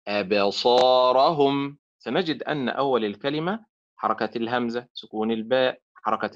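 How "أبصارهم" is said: أبصارهم stretched very slowly; teacher holding the word for students; slow model word. Shown as a demonstration. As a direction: linger on each sound of أبصارهم. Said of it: "أبصا فعل وليست أبصا".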